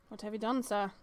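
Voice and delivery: ordinary talk, nothing marked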